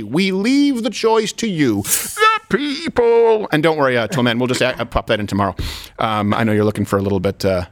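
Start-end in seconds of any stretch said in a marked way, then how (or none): 0.0-3.5 slow, drawn-out grand proclamation; grandiose. Acted. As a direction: proclaim it like a ruler. Grandiose sign-off to audience.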